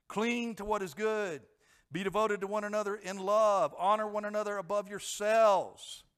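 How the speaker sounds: loud, driving sermon with rising zeal; fervent preaching. Acted. Pastor urging the congregation to zeal, loud and fervent. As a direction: preach it out with full fervor.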